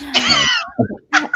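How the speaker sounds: a sudden, loud, breathy burst of laughter. Real, not acted. Host bursts out laughing after praising her husband.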